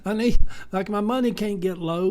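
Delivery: high, panicky mock freak-out; half-playful panic over the light bill. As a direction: act out the money worry in a high fret. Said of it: "We kinda freak out a little bit".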